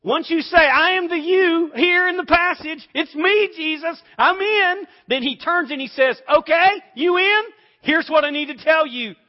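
loud, high, driving preacher's voice; big revival-style delivery; preaching at full force. Acted. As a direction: preach it hard to the congregation.

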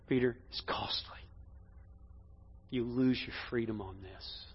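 low, soft, near-whispered caution; hushed warning. Acted. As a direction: tell him quietly what it will cost. Voices Jesus warning Peter gently.

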